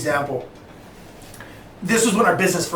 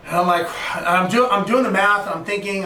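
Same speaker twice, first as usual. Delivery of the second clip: ordinary talk, nothing marked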